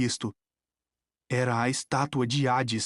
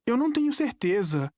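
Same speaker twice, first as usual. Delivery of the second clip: higher, pleading uncertainty; mildly desperate, raised pitch; 7 semitones higher than usual. A narrator's reading. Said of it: "disse eu desesperado".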